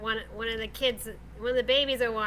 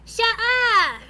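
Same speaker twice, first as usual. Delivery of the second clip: high, loud shout at dogs; mom's yell; 10 semitones higher, 9 dB louder than usual. Retold or imitated. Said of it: "it's more like".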